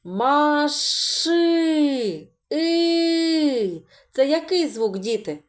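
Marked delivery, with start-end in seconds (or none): 0.0-3.8 И held long and high; teacher isolating И; sounding out И. Shown as a demonstration. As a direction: stretch the vowel И. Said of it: "Далі".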